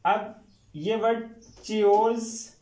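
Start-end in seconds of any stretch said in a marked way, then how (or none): 1.6-2.5 "चियोज" slowly with an initial ch sound; mispronounced word. Shown as a demonstration. Teacher shows how the spelling misleads students, mispronounced. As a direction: draw out "चियोज" as chee-oze. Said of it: "चियोज नहीं students".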